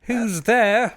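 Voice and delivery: loud, high mock call; overdone polite call, rising up high. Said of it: "They're so polite about it".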